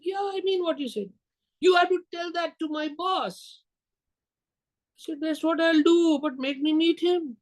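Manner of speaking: high-pitched put-on voice, retelling a bargain; imitated dialogue. Secretary and salesman bargain over meeting her boss, high imitated voices.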